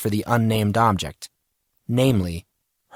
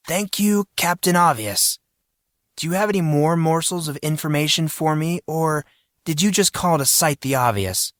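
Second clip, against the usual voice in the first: mild sarcasm; 6 semitones higher than usual. A narrator's reading. Sarcastic retort to friend on phone.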